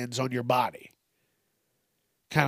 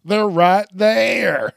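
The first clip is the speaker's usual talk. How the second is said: much higher, louder, slow shout through laughter; laughing shout; 7 semitones higher, 7 dB louder, much slower than usual. Acted. Pointing out the shoes, a slow laughing shout. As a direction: yell it while cracking up.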